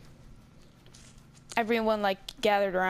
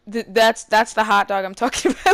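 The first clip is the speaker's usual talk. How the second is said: laughing loudly; 10 dB louder than usual. Real, not acted. Excited about the hot dog reveal.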